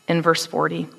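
ordinary delivery

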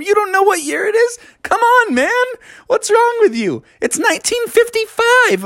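very high, mocking beaver voice; scoffing. A narrator's reading. Beavers mock Billy's question, scoffing. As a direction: jeer at the clueless newcomer. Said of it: "They laughed at him".

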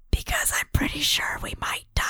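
hushed secret aside; whisper. Acted. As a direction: whisper it so one companion can't hear. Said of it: "I whisper this part".

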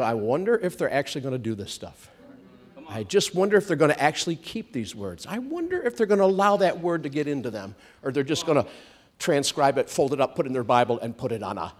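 low, quiet devil musing to himself; lightly voiced devil, wondering under his breath; watching devil. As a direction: speculate softly, as the lurking devil. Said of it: "Do you know the devil".

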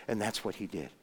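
normal talking voice

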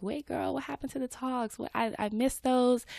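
lightly voiced disappointed listener; imitating a confused follower. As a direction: play a fan missing the talks. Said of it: "you're like".

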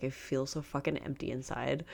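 ordinary delivery

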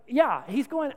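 usual speaking voice

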